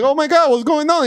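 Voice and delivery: high-pitched retold alarm; imitating panicked aunt. Aunt finds the screaming nephew, imitated panic. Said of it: "And there comes my aunt".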